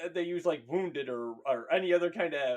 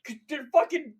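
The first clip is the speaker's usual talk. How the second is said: higher and louder, alarmed and laughing; panicked soldier; 7 semitones higher, 4 dB louder than usual. Retold or imitated. Ambushed soldier panicking over the indecipherable comms. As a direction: panic as the door comes in. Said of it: "It's just like".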